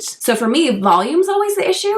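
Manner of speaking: talking while applying mascara. Real, not acted. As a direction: speak with mouth stretched for mascara. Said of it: "Why am I trying to talk while doing mascara?".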